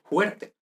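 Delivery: "fuerte" said as "juerte"; dialectal aspirated F. Shown as a demonstration. Teacher quoting village speech, "fuerte" pronounced "juerte". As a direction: open "fuerte" with a Spanish j sound, not f. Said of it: "en muchas variedades del español".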